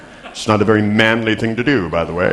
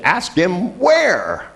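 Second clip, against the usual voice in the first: much higher, very drawn-out phone instruction; insistent repeat; 10 semitones higher, much slower than usual. Retold or imitated. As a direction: stretch each word high for a baffled listener. Repeats instruction after caller's "What?.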